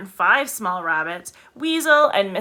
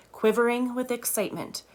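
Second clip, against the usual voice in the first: plain reading voice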